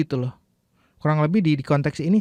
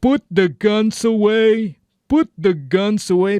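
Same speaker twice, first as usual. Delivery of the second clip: mild film command; 6 semitones higher, 4 dB louder, slower than usual. Shown as a demonstration. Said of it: "repeating the film line".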